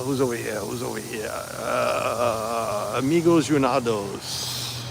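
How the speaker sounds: drawn-out Portuguese-accented name. Real, not acted. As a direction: slip into a Portuguese accent, slowly. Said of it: "I don't know why I break into, like, an accent".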